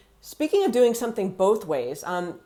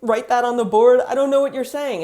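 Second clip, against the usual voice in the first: higher, slightly louder retold request; lost teacher pleading; 4 semitones higher, 5 dB louder than usual. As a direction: re-enact a baffled teacher asking for help. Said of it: "I was completely lost".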